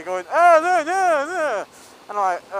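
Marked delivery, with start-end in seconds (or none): 0.3-1.6 mocking sing-song, much higher and louder. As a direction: tease him by mimicking his caution. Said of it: "taking the mick out of me".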